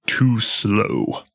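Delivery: a lightly voiced dark villain, cool and cocky; just a hint of Ansem taunting, close to the own voice; Ansem's voice. Fan retelling a cutscene, lightly doing Ansem's taunt. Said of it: "in Ansem's voice".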